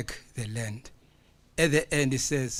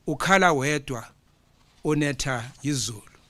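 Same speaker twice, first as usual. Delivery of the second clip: poem recital; 2 semitones higher, 6 dB louder than usual. Acted. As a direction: proclaim each line like an imbongi to the hall. Recites Zulu poem to conference.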